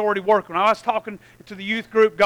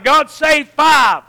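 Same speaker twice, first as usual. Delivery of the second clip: very slow, loud plea, each word weighed; bold prayer; 4 semitones higher, 6 dB louder, much slower than usual. Retold or imitated. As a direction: lay down the demand word by word. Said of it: "I'm gonna be bold about it".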